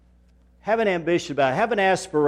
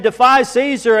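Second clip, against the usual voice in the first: high loud call of the crowd; a preacher voicing a rioting crowd; mob cry; 9 semitones higher, 6 dB louder than usual. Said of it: "they're saying".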